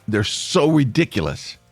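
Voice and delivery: ordinary delivery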